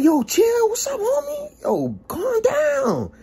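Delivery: squealing high, alarmed plea; panicked pleading. Retold or imitated. Telling the possessed DJ to calm down, panicked. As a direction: beg him to settle, stretching the last words.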